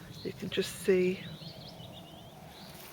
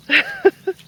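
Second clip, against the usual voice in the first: much higher burst of laughter; 9 dB louder than usual. Real, not acted. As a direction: laugh at the dog's mess. Dog in pond.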